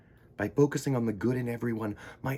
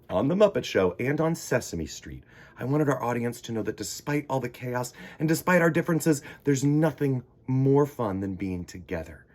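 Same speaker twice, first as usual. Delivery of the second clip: ordinary delivery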